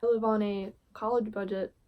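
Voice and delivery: ordinary delivery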